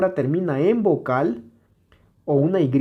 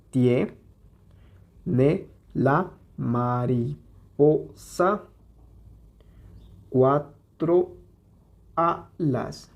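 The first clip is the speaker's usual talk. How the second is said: "Tiene la mariposa cuatro alas" split into syllables with long pauses; sílaba por sílaba; much slower than usual. Shown as a demonstration. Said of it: "Vamos a separarlas en sus sílabas". ("Tiene la mariposa cuatro alas" muy lento, separando cada sílaba)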